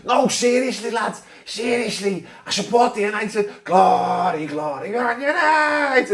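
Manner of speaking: mock Scouse fan chanting Glory, Glory, Man United. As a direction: play a Scouse lad begging other fans to believe him. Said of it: "a Man United fan with a Scouse accent".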